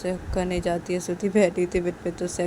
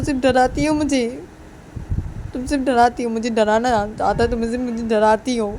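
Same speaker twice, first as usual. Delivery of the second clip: loud, upset scolding; acted indignant reproach; hurt accusation; 2 semitones higher, 3 dB louder than usual. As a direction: pour out the grievances at your partner. Accusing spouse of scaring, not loving.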